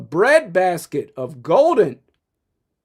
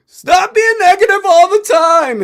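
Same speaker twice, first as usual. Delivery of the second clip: high, loud nagging whine; mocking whiny fan; 13 semitones higher, 8 dB louder than usual. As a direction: mimic a scolding fan, pitched way up and loud. Mocking fans who call him negative.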